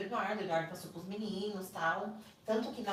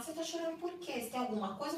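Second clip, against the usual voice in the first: retold suspicious questioning; 8 semitones higher, faster than usual. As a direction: imitate an interrogating guard, pitched up. Guard questions the crying visitor.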